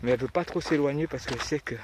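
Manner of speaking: ordinary talk, nothing marked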